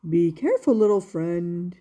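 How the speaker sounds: kindly maker, voiced low; Eli the maker. Woodcarver answering his little puppet, low and kind.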